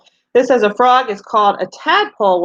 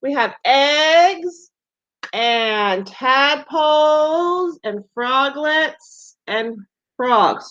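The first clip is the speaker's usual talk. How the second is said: slow teacher listing; 3 semitones higher, much slower than usual. Shown as a demonstration. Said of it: "the pictures that we saw".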